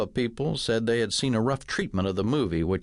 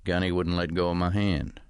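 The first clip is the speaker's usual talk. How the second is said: usual speaking voice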